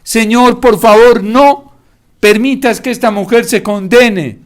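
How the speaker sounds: high, loud, weeping prayer; anguished sobbing prayer; tearful plea. Acted. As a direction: beg through tears for her soul. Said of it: "lloraba como San Pablo".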